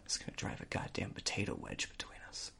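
creepy whisper; hushed, quick, menacing whisper. Pretend. Potato pun whispered.

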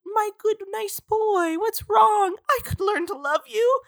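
put-on high, soft, doting girl voice; hammed-up lovesick high voice; mock sweet Bella. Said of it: "And Bella is like".

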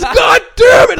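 loud, high mock curse through laughter; furious outburst. Son imitating dad's angry curse, loud and laughing. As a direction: explode in fake rage while laughing. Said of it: "he goes".

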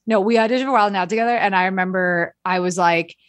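ordinary talk

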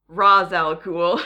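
"Ra's al Ghul" with Ra's said like "rahz"; Liam Neeson's pronunciation. Shown as a demonstration. Host gives the Liam Neeson version of the name, Ra's as "rahz". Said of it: "if you're Liam Neeson".

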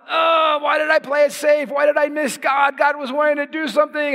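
high, groaning self-reproach; hammed-up anguished wail of regret; acted regret. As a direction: lament it like a missed chance. Acted regret at missing the nudge.

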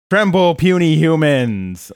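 loud, menacing alien villain; booming arrogant alien overlord. Retold or imitated. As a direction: boom it out like a tyrant alien. Morbo voiced in a recap.

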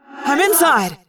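high-pitched urgent report; calling out. A narrator's reading. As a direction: call it out from mid-flight. Tobias calls out mid-attack.

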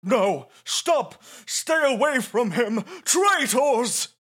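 high, loud hammed-up outrage; Trammell. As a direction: shriek it theatrically at betrayers. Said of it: "you have the Trammell voice".

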